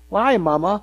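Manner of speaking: lightly voiced child's question; a hint of a curious tot, rising at the end; inquisitive tot. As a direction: imitate a little kid asking mama. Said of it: "starting to ask those inquisitive questions".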